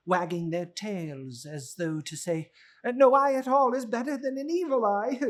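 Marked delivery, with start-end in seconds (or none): 2.8-5.2 much higher and louder mock warning; dog's voice. Blind man's dog warning its owner, high and loud. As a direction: voice the dog as a little warner. Dogs' imagined speech.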